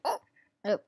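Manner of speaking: hiccuping mid-sentence; words cut by a hiccup. Real, not acted. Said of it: "I have the hiccup".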